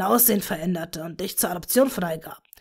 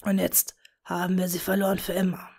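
wistful whisper. A narrator's reading. Tom to Bella, mourning their lost daughter, wistful whisper. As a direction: murmur it like a grieving father. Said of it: "flüsterte wehmütig".

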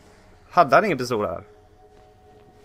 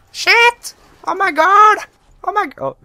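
shrieking "Bort!" at an attacker, much higher and louder; real panic, screaming high and loud; panicked screams; 9 dB louder, much slower than usual. Real, not acted. Gamer attacked by enemies, panicked screams.

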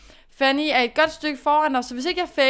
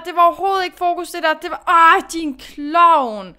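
fast, loud, higher tirade at herself; exasperated self-scolding; 4 semitones higher, 7 dB louder than usual. Real, not acted. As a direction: berate yourself, fast and loud. Scolding herself after mistake.

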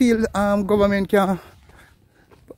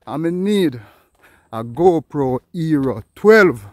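ordinary delivery

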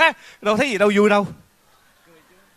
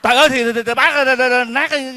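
high, loud stammering exasperation; sputter, too worked up to finish; flustered outburst; 10 semitones higher, 5 dB louder than usual. Acted. Host sputters loudly at the old man's nonsense.

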